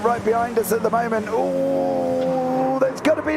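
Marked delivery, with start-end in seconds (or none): 1.3-3.4 rising alarm as the crash happens; high, gasping, caught by surprise; excited live call. Real, not acted.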